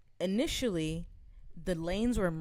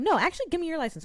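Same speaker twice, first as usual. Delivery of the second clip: demanding quote; 11 semitones higher, 5 dB louder, faster than usual. Retold or imitated. Lady demands license.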